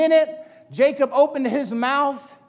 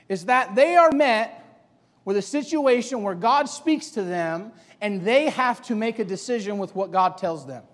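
ordinary delivery

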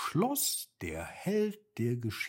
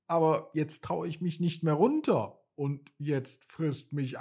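put-on higher-pitched, mildly fearful child; anxious little boy; 6 semitones higher than usual. A narrator's reading. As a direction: plead with a touch of fear, lightly voiced. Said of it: "Der Junge sah ihn ängstlich an". (leicht ängstliche, höhere Jungenstimme)

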